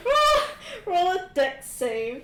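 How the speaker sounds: cracking up mid-sentence; laughing through the words. Real, not acted.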